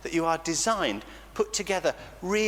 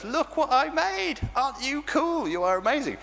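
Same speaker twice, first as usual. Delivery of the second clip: beam over your creation like a proud maker; delighted God; 10 semitones higher than usual. Retold or imitated. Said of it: "God gets when he looks at you and goes".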